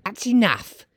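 high start, settling a little; high, dismissive woman; brazen charwoman. Acted. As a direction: argue it in a high character voice. Charwoman argues on.

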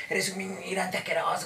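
high, put-on indignant voice; mock insulting customer. Oyente imita al cliente que insulta, indignado en broma.

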